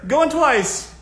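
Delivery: high, loud going twice; auctioneer call. Shown as a demonstration. Vlogger running a mock auction for suitors, loud auctioneer call. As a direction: rap the gavel with your voice. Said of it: "start treating it like you're an auctioneer".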